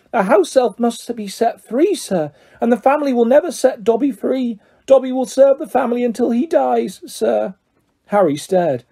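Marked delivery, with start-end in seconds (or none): 0.1-7.5 high, dutiful house elf; Dobby, humble. A narrator's reading. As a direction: servile little creature, pitched high.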